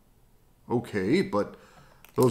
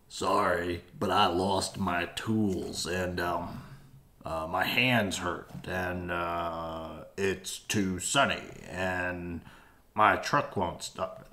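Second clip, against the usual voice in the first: lazy excuses voice; 5 semitones lower, 3 dB quieter, slower than usual. Acted. Lazy builder making excuses.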